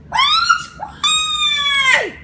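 put-on very high-pitched upset kid voice; high squeaky voice. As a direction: whine it way up high. [name]'s put-on voice.

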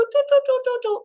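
high chirpy imitation of birds in the trees; birdsong imitation. Fan recalls hearing birds, imitates them high. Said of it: "I could hear birds, go".

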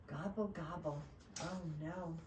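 low, quiet gobbling; dejected turkey. Acted. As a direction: sink into soft disappointment. Turkey's disguise has failed again.

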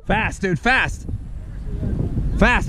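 usual speaking voice

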